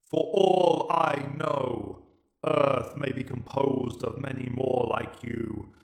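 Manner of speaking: slow, cold alien verdict; Dalek Emperor. Acted. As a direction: judge him like an unfeeling machine ruler. Emperor decides.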